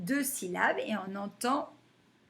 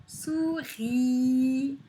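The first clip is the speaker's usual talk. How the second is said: Souris split as sou-riii with a long i; held, chanted sou-ris; stretched syllables. Shown as a demonstration. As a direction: draw out the end of Souris. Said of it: "on l'entend".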